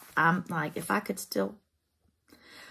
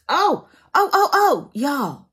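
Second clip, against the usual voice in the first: surprised squeal; 7 semitones higher, 8 dB louder, slower than usual. Real, not acted. Finds lost item.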